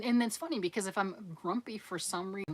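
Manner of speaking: ordinary talk, nothing marked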